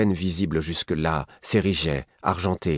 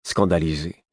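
ordinary delivery, nothing marked

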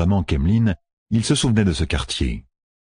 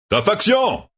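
bellowing; slower than usual. Acted. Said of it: "beugla-t-il".

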